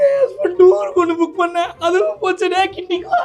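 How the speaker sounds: hammed-up sobbing complaint; fake-cry about the booked tour; mock crying. Wife crying to the airline about her husband's tour, mock sob.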